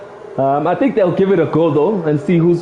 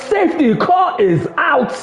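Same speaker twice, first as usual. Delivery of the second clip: excited; 11 semitones higher than usual. Real, not acted. Crash brings safety car.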